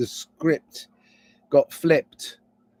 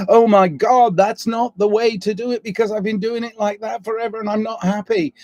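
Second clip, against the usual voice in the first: exasperated inner outburst; 8 semitones higher, 4 dB louder than usual. Retold or imitated. Frustrated thought voiced aloud.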